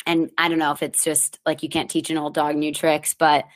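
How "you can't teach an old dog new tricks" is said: ordinary delivery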